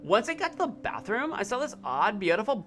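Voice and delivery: lightly voiced Valley Girl reading. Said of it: "do the Valley Girl again".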